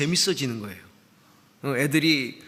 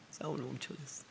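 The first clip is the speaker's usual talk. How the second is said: 싸움을 멈춰버렸어요 said again much softer, almost breathed; hushed repeat; 3 semitones lower, 16 dB quieter than usual. Real, not acted. Repeating to the congregation that he stopped fighting, hushed. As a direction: let the line sink into a soft murmur.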